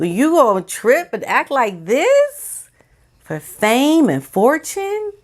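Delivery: high, slow, incredulous telling-off; disbelieving scold. Real, not acted. Vlogger scolding Meghan over fame, slow incredulous reproach. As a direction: rebuke her like a disappointed aunt.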